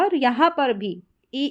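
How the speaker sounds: ordinary delivery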